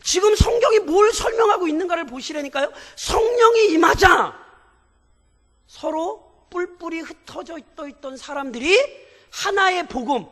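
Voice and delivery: pastor rebuking the congregation's careless reading, at full force; fiery preaching. Acted. Preacher urging the church to see the Bible's meaning, shouting with fervour.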